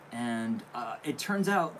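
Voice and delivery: ordinary talk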